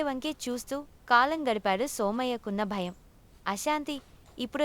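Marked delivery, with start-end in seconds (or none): none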